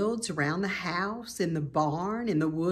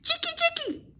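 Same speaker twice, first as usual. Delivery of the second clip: very high, louder scolding chirp; scolding swallows; 6 dB louder than usual. A narrator's reading.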